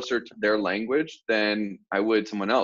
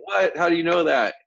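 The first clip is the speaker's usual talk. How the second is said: high, startled mock disbelief; imitating the client's amazed reaction; surprised; 7 semitones higher than usual. Middle Eastern client reacts to the greeting, higher and surprised. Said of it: "they'll be like".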